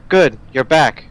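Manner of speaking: happy relieved welcome; glad excited greeting. Acted. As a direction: burst out with joy at their return. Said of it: "She's like".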